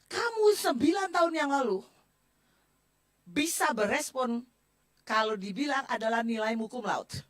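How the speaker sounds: a loud mock rebuke from the leader; imitating a stern rebuke. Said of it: "pemimpin cuma bilang gini". (menirukan teguran keras pemimpin)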